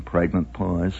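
normal talking voice

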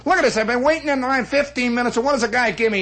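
high, quick mock outrage; appealing to crowd. Slob complains to the waiting crowd, outraged appeal. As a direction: bluster to onlookers as a wronged customer. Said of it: "customer that appeals to the crowd".

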